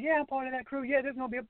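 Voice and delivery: very high-pitched excited imitation; high, thrilled impression of a friend. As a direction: mimic an excited woman, pitch shooting way up. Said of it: "in her excited voice".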